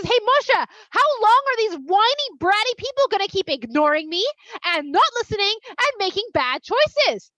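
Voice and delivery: loud, high, hammed-up indignation; exasperated complaint. Hashem complaining to Moshe about the people, exasperated.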